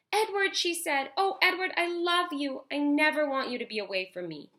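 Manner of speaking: put-on high, tender girl voice; little girl voice. A narrator's reading. Girl holding her lost rabbit close: high tender voice. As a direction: hug him and gush with relief.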